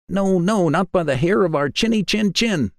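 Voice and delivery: very high, squeaky pigs refusing; high squeaky piglets standing firm; little pigs' voice. A narrator's reading. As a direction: pitch it way up like tiny pigs.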